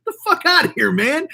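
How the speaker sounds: scoffing outburst. Real, not acted. Scoffing at ear-piercing tutorials.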